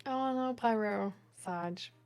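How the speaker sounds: normal talking voice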